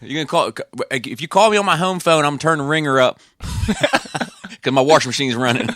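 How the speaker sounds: put-on high Southern Maryland accent, laughing through it; hammed-up Southern Maryland accent, home phone with a long O. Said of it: "that's a Maryland".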